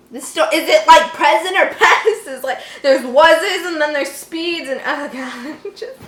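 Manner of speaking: laughing through confused words. Real, not acted. Reader laughing at the story's mixed-up tenses. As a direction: giggle at the clumsy tenses.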